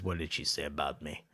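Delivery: low, soft Italian husband voice; comic low-voiced old husband. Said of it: "brings the guy in".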